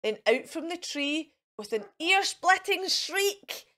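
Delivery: ordinary delivery, nothing marked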